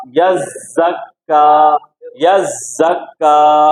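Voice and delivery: यज़्ज़क्का slow, long buzzing zz and held kk; correct doubled reading. Shown as a demonstration. Teacher corrects the student's mistake, slow doubled recitation. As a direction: stretch यज़्ज़क्का, leaning on both doubled consonants. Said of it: "क्या होगा?".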